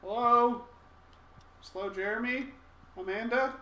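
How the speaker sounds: loud, high calling into the house. Pretend. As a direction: shout for missing people.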